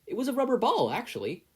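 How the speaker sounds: put-on high, eager teen voice; a teen explaining his quirk to a stranger, high and eager; nervous boy. A narrator's reading. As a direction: blurt it out a little too high. Izuku speaking.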